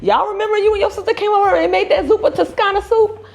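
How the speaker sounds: a hint of an excited relative; imitating a family member. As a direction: mimic her friend's family. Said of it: "her family was like".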